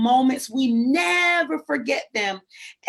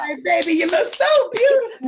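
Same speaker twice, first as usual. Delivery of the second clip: high, loud, cooing mock grandma praise; hammed-up family flattery, pitched way up; doting grandma; 8 semitones higher, 6 dB louder than usual. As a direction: gush over the child like a grandmama. Mimicking family praising a child.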